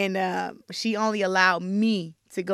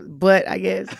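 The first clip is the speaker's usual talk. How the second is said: laughing; 8 dB louder, slower than usual. Real, not acted. Laughs at own joke.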